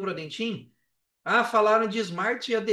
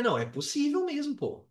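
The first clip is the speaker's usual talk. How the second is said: excited realization; 8 semitones higher than usual. Retold or imitated. Retold realization it's possible.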